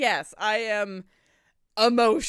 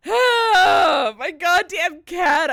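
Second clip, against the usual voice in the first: overjoyed shout; 6 semitones higher, 11 dB louder, much slower than usual. Real, not acted. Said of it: "I'm so emotional and happy".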